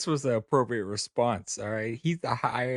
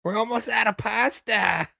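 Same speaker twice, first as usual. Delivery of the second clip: Fran impression; 9 semitones higher than usual. Mimicking Fran at a catered party.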